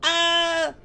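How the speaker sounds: sudden high playful shriek; mock scream. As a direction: scream in jokey fright. Mock scare, trading screams.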